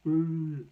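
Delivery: high, loud drawn-out "woo"; loud whoop. Retold or imitated. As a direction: imitate brother whooping loudly. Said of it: "he was like".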